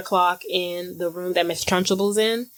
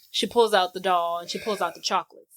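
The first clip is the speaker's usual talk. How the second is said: normal talking voice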